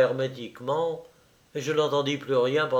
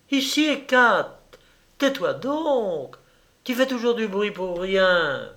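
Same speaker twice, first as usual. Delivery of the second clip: put-on exasperated girlish reprimand, pitched up; scolding the dog; 7 semitones higher than usual. A narrator's reading. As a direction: scold a noisy pet from a distance.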